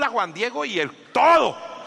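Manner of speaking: ordinary talk, nothing marked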